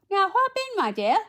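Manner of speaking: high, loud, hurrying-along fairy voice; commanding storybook godmother. A narrator's reading. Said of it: "said the fairy godmother".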